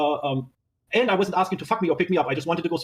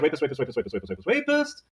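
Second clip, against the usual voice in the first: droning repetition; 4 semitones lower, 3 dB quieter than usual. Retold or imitated. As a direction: reel off the repeats, then lift the final one. Reading out a repeated accusing text.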